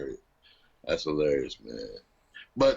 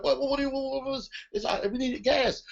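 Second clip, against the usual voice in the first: freaking out; 12 semitones higher than usual. Retold or imitated. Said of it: "she's, like, freaking out".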